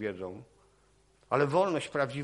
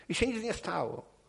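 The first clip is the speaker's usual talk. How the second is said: ordinary talk, nothing marked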